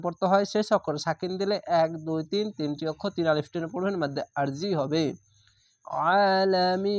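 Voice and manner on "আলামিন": আলামিন chanted with a held ending; recited stop. Shown as a demonstration. Teacher shows how to pause at the verse end, chanted. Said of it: "এভাবে থেমে যাবেন।".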